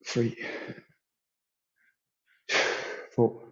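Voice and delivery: quiet counting under the breath. Real, not acted. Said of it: "count under my breath".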